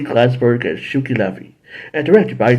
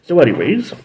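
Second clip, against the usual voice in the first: ordinary delivery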